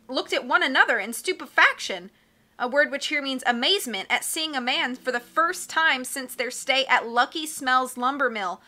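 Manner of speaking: usual speaking voice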